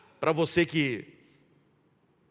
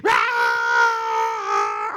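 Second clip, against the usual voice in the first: imitated cry; 7 dB louder than usual. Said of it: "ela cai no chão e sai rolando".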